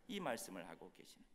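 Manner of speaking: usual speaking voice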